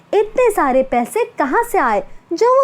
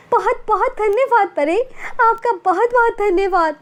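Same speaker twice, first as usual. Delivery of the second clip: overjoyed disbelief; 6 semitones higher than usual. Acted. Girl hears fairy's magical promise.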